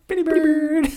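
high squeaky parrot imitation. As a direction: squawk it like a parrot. Parrot voice again, higher.

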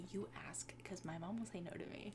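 hushed whisper to a friend; whispered plea. Retold or imitated. Kid whispering to a friend during a play date. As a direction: whisper the scheme secretly. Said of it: "it's like".

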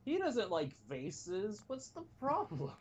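high, slow, puzzled mock voice; puzzled inner voice. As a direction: voice a clueless inner thought. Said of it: "my mind thought".

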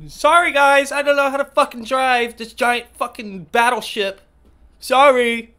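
high, loud, whiny mock apology; mocking the bad driver. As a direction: ham up a clueless driver's sorry. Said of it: "finally he rolls his window down".

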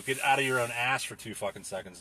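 normal talking voice

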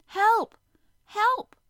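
high, loud shouts across the water; shouting desperately across the sea; desperate cry for help. Acted. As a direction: call out for rescue at full voice. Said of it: "Conseil gave another desperate cry".

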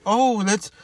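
high, bright mock-cheerful invitation; mocking cheerful fifty-year-olds. Speaker mocks healthy older people, high mock-cheerful voice. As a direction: chirp it like a carefree middle-aged friend. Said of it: "and they can say".

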